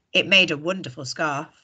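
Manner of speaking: plain reading voice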